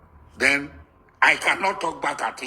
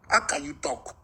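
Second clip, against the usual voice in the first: usual speaking voice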